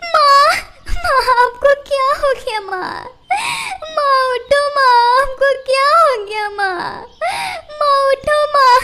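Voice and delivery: high, loud wailing sobs over her mother; loud crying. Acted. Daughter finds her mother lying dead, wailing sobs. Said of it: "और रोने लगती है".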